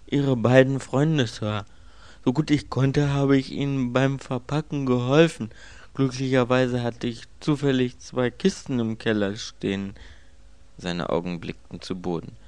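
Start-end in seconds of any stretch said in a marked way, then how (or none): 0.1-9.9 higher, louder manservant voice; obliging servant, pitched up; deferential servant. Acted. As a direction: report dutifully to the master.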